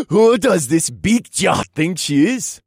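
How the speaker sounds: put-on loud, high, slow drunken jeer; laugh, then slur it scornfully; mocking drunken laughter in the voice. A narrator's reading. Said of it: "the blonde man laughed".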